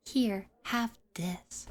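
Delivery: normal talking voice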